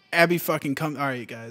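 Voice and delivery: ordinary talk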